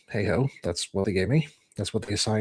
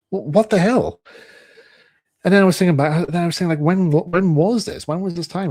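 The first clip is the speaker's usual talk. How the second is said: louder, higher outraged questions; indignant disbelief; 7 semitones higher, 6 dB louder than usual. Retold or imitated. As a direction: ask it as if he cannot believe it. Indignant retold thoughts.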